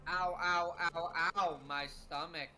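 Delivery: low, flat, lifeless ow ow; deadpan reading. Retold or imitated. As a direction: read the pain cries with zero emotion. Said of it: "the deadpan delivery of that".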